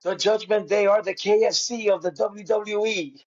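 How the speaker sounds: lightly voiced commentator line; Michael Cole impression. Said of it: "Michael Cole tells Pat".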